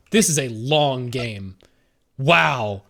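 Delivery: ordinary talk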